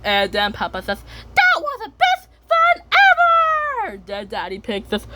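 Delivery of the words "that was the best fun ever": very high, squealing delight; piercing, overjoyed child voice; thrilled squeal. Acted. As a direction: shriek it with joy, stretching the last word. Peppa thrilled after the ride.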